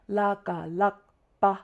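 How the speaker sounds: "la, ga, lak", then "pa" set apart; step-by-step oral spelling. Shown as a demonstration. Teacher spelling the longer word, recited step by step. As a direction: recite "la, ga, lakpa" in separate beats. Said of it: "we would say".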